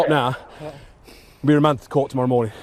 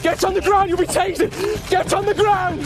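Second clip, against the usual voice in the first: high, forceful shouting; shouted command; slower than usual. Real, not acted. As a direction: bark the order at full force. Officer orders fleeing suspect.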